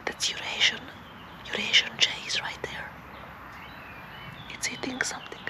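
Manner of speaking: hushed, unvoiced whisper. Real, not acted. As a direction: whisper it softly while watching a bird. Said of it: "I don't know why I'm whispering".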